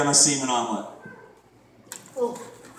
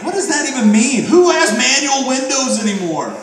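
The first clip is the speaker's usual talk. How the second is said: mock indignation; 8 semitones higher, 6 dB louder than usual. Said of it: "And I'm like".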